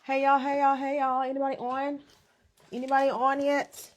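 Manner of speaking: high sing-song call to viewers; live greeting call. Real, not acted. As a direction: call out brightly to the chat.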